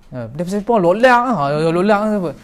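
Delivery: imitating a Kedah speaker's rough northern Malay; mock Kedah dialect, a touch blunt; Kedah accent. Teacher imitating a Kedah speaker to the class, in a Kedah accent. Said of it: "Orang Kedah cakap mungkin nampak macam kasar".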